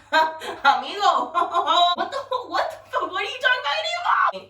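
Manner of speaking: high, laughing, shouted retort to a cheater; laughing disbelief. Retold or imitated. To a cheating friend's man at the club, laughing shouted disbelief. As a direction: crack up while yelling at him in disbelief.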